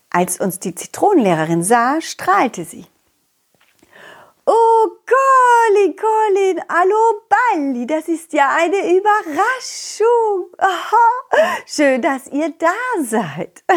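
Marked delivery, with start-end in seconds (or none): 4.5-13.8 high, slow, quavering lemon teacher; a delighted old schoolteacher, drawn out; sweet old teacher voice. Acted.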